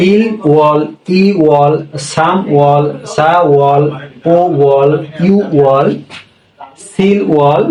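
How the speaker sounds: normal talking voice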